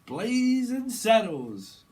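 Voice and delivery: high, very loud, long-stretched call; big mock holler. Reader joking after saying saddle, big mock holler. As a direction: holler it out, stretching every vowel.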